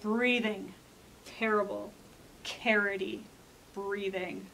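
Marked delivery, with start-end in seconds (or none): none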